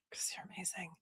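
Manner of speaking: soft whisper, almost no voice; whispered aside. Real, not acted. Streamer whispering a compliment to a donor. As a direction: breathe it like a secret. Thanking donor Wise Old Owl.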